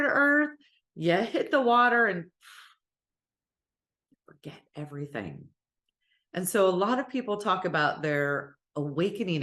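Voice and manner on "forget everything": soft breathy whisper, much quieter. Acted. Soul landing on Earth and losing its memory, whispered. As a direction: drop to a hush as everything fades. Soul hits the water, forgets.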